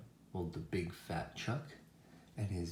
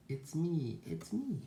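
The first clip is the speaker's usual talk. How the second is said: high duck voice, rising higher; pitched-up excited reveal; duck character; 8 semitones higher than usual. A narrator's reading. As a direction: announce yourself as the storybook duck. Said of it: "says the duck".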